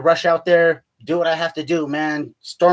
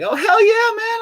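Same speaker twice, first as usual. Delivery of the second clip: much higher, mock-delighted hell yeah; mock excited cheer; slower than usual. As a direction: cheer it like you can't believe your luck. Mock cheer about tax scheme.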